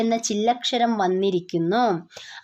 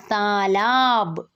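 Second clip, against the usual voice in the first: slow demo reading; much slower than usual. Shown as a demonstration. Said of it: "അപ്പുറത്ത് ചിഹ്നങ്ങളൊന്നുമില്ല".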